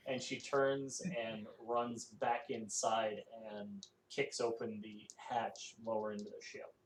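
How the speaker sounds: usual speaking voice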